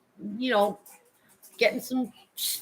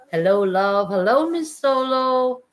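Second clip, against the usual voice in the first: sing-song greeting; 6 semitones higher, 5 dB louder, slower than usual. Real, not acted. Greeting viewers.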